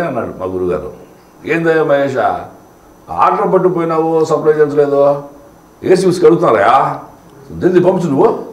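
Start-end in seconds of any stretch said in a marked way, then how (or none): 1.5-8.4 higher, louder Nellore-slang bar owner scolding; Nellore slang impression. Audition piece mimicking the Nellore bar owner, loud Nellore slang. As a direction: rattle it off like the Nellore bar owner chasing an order. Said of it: "Nellore ఆయన మాట్లాడే మాట imitate చేయమని".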